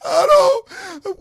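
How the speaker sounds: high, drawn-out mock wail; imitating a child's tearful tantrum; crying six-year-old. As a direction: whine it loudly like a bawling little boy. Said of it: "He's crying".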